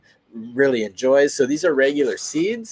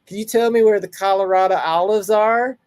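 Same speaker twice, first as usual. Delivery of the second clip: put-on Southern accent; 6 semitones higher, 4 dB louder than usual. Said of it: "like, a Southern ac-, accent".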